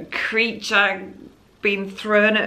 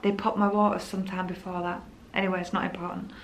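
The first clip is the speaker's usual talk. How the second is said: usual speaking voice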